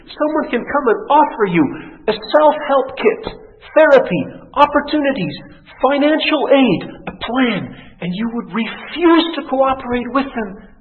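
high, emphatic listing; fervent, insistent preacher; impassioned preaching. Acted. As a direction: punch out each item from the pulpit. Preacher at full force.

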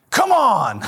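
high, loud kid mimicking daddy's road rage; little girl parroting an angry yell; child copying dad. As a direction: daughter imitating her father's driving, mock shout. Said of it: "she's walking around the house going".

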